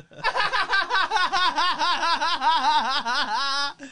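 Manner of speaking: high, giddy laughter rolling on; long delighted laugh. Real, not acted. Streamer laughing after the last-second question, giddy laughter. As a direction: laugh out the relief and joy.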